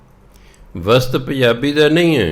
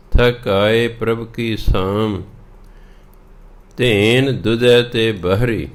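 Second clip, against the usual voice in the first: slow, measured Gurbani reading; scripture recitation; slower than usual. Acted. Teacher reading the shabad to learners, slow recitation. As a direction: recite each line slowly, drawing out the words.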